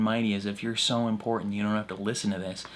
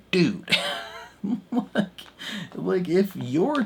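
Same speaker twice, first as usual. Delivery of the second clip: exasperated; 10 semitones higher, slower than usual. Retold or imitated. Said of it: "I was like".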